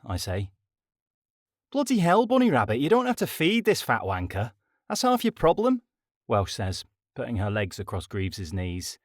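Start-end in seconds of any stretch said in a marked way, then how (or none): 1.7-5.8 high, loud, exasperated telling-off; exasperated scolding. A narrator's reading. Welsh scolding Rabbit for feeding Greaves, loud and high. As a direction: scold her like a bossy big sister.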